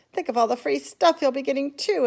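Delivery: envious. A narrator's reading. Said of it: "another boy said enviously".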